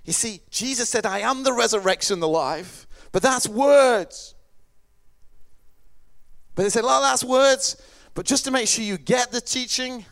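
loud, high, emphatic argument; forceful preaching. Real, not acted. Preacher argues words need proof, forceful preaching. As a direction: drive the point home to the congregation.

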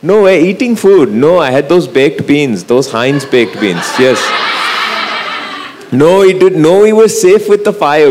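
put-on reassuring Mom in a Sri Lankan accent. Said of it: "I've flicked into my Sri Lankan self".

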